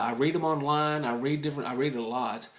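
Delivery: ordinary delivery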